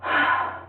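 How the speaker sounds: breathy sigh of release; relieved sigh. Shown as a demonstration. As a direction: let out a long relieved sigh. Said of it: "your baby will do like this".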